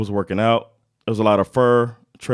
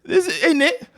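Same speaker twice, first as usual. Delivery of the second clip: posh high British voice, mocking colonizers; hammy English toff claiming land; British accent; 12 semitones higher than usual. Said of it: "I suck with a British accent".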